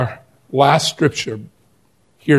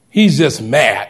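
ordinary talk

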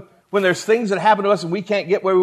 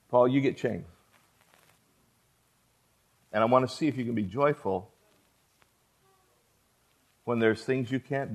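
solemn God; 7 semitones lower, 11 dB quieter than usual. Acted. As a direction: deliver the verdict gently, almost hushed. God's solemn verdict to Paul.

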